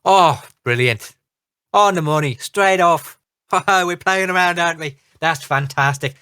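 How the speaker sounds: flustered halfling captain in a light Irish brogue; bumbling, friendly captain with a touch of brogue. Acted. Captain Flagonfoot introduces himself to the party, in a light Irish brogue. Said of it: "a very thick brogue comes out".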